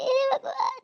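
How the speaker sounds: high but quiet tearful sigh to God; faded tearful hey Bhagwan. Acted. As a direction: let the cry to God fall away. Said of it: "आपको रोना नहीं है बिल्कुल".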